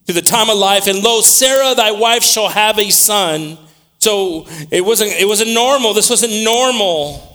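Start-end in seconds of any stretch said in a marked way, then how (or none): 0.1-3.6 high-pitched, projected Bible reading; preaching scripture. Acted. Preacher proclaiming that Sarah shall have a son, high preaching delivery. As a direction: proclaim the promise in a raised preaching voice.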